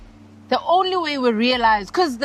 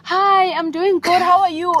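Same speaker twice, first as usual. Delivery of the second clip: overeager chirpy reply; 8 semitones higher, 4 dB louder than usual. Retold or imitated. Someone answering a casual greeting, mock eager. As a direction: answer a passing greeting like a long-lost friend. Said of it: "when ube like".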